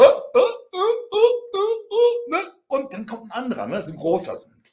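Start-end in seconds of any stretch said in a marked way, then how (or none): 0.0-2.3 high, loud mocking "äh" bursts; mock yelps, each one separate; imitated opponent's grunts. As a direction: repeat a high silly grunt, mimicking the opponent. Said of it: "der ging immer so".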